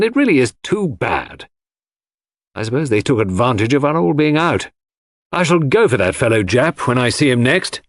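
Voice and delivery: indignant grumble, pitch climbing as it goes; exasperated anger. A narrator's reading. John fuming about detectives searching every room, exasperated anger. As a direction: complain crossly, leaning on the grievance. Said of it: "wore an unaccustomed frown of anger".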